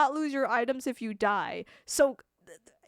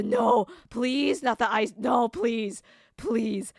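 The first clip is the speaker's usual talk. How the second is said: loud begging, stretching the last please; loud, repeated mock begging; mock pleading; 6 dB louder, much slower than usual. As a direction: plead desperately against exile, hammed up. Pleading against exile.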